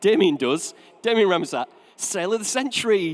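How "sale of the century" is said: higher, announcing a TV show title; a hint of a retro TV host; game show announcer. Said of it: "Do you remember that?".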